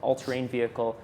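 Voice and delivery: ordinary delivery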